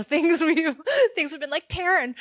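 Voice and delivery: high, loud words breaking into laughter; laughing. Real, not acted. Colleague recalling what the team threw at their leader, laughing. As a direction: laugh through a mock call.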